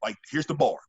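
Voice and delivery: usual speaking voice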